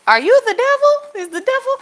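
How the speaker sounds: high, loud incredulous "are you the devil"; mock horror at a pushy man; thinking out loud. Talking to herself about the man, high and incredulous. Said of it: "I'm just thinking".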